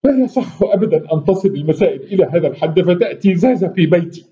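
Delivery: plead, voice tightening with hurt; choked voice. Acted. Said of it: "اختنق صوت كريمة".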